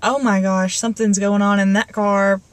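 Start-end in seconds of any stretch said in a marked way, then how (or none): none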